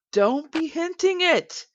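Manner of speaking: high, slow, affronted scolding; a snooty hotel owner slowly scolding the detective; indignant scolding. Acted. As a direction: draw it out high and offended.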